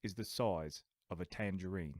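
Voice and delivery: low British actor impression; Michael Caine. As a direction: put on a British film star's accent. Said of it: "I could be Michael Caine".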